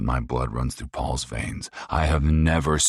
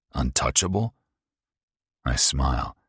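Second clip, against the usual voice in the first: ordinary delivery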